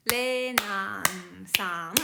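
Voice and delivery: "Renan-san" stretched very slowly, each sound held equally; "Renan-san" in long, even, level beats; same length morae. Shown as a demonstration. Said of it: "three sounds and same length".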